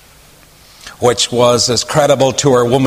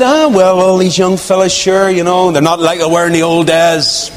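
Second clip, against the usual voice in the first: gossiping woman; 4 semitones higher than usual. Retold or imitated.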